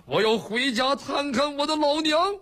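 high-pitched, hammed-up tearful lament; tearful wailing complaint. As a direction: wail it like an aggrieved son missing his mother. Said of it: "你哭什么呀？".

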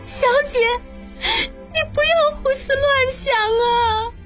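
high-pitched sobbing plea; crying. A narrator's reading. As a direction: plead through tears, drawing the words out. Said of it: "喜凤哭道". (哭着哀声劝说)

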